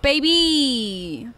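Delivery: usual speaking voice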